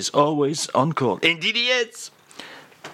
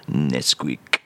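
deep punchline; 12 semitones lower than usual. Acted. Punchline delivered drop.

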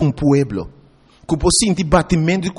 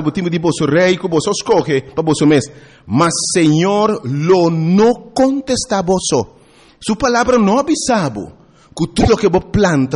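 ordinary talk, nothing marked

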